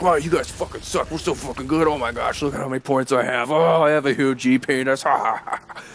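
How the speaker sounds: hammed-up gloating opponent voice; boastful gamer imitation. Other team gloating in a game, mock boastful voice. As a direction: brag and crow with a mocking fake laugh. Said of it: "guys on the other team are like".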